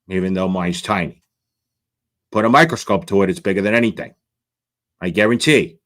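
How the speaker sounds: low-pitched mock official laying out his plan; mocking self-important official. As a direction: put on a low, pompous boss voice. Acts out the official's petty reasoning.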